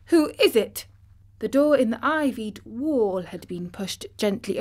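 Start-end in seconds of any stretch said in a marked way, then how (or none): 0.1-0.9 higher startled question; quick alarmed asking. Acted. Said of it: "he said quickly".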